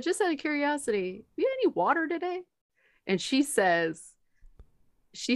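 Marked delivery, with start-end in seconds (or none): 0.0-2.4 higher, softer careful question; tactful asking. Retold or imitated. Nurse asks the patient about water, high and tactful. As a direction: ask lightly, pitched up and softer. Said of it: "I said".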